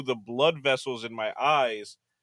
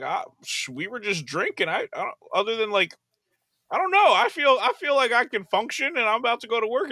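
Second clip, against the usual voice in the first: shrugging it off; 7 semitones higher than usual. Retold or imitated. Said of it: "I was just like".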